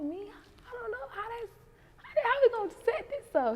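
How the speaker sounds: high, incredulous question; disbelieving me. Retold or imitated. Woman told to date [name], high and disbelieving. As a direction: sound amazed it's you. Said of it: "I'm like".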